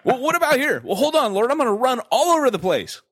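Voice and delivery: playful high pretend voice asking God; mock talk with God. Acting out asking God about places to go, playful and high.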